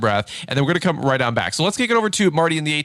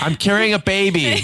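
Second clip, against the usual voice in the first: imitating wife; 7 semitones higher than usual. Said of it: "And she goes".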